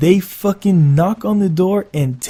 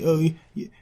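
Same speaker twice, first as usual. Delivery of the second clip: scared stuttering mumble; 4 semitones higher, 4 dB quieter than usual. Retold or imitated.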